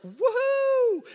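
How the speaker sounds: high, loud whoop of joy; elated, soaring shout; jubilant cheer. Acted. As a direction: whoop like a celebrating crowd. Gentiles cheering in acted quote.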